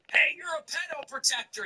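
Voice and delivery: high-pitched put-on heckler voice; mock heckler. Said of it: "some blue collar hick lib".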